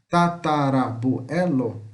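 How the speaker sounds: slow ta - ta - ra - bu - e - lo; measured, syllable-by-syllable Tatarabuelo; syllable separation. Shown as a demonstration. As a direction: break Tatarabuelo into deliberate syllables. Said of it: "separação de sílaba".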